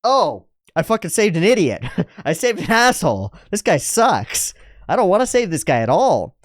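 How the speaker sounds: put-on exasperated girl. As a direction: roll your eyes at the idiot you just saved. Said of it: "Eris's face tells it all".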